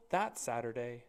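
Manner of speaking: ordinary delivery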